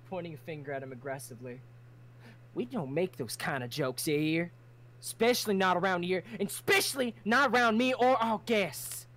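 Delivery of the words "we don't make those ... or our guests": louder, rising stern reprimand; stern snap. Acted. Crimson rebuking Tally for a dark joke, stern and loud. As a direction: lay down the law firmly. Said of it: "Crimson snaps in his direction".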